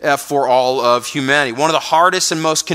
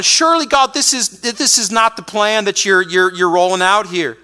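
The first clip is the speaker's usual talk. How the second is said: much higher, protesting to God; incredulous prophet; 4 semitones higher than usual. Retold or imitated. Habakkuk protesting to God, voice shooting up high. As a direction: push back in disbelief. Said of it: "He's going".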